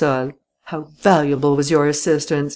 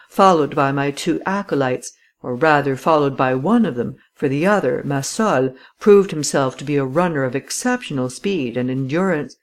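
plain reading voice

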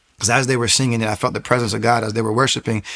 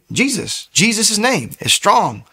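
higher-pitched, emphatic confident declaration; 9 semitones higher, slower than usual. Retold or imitated. Pastor tells him what to say at home, confident. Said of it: "but he's so confident".

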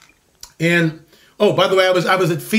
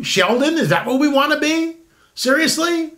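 incredulous; 8 semitones higher than usual. Real, not acted. Incredulous rhetorical questions.

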